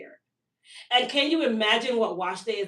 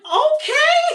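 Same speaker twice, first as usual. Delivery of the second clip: much higher and louder, rising "Okay?"; a sudden high surprised okay; 9 dB louder than usual. Retold or imitated. Realizing the mask works.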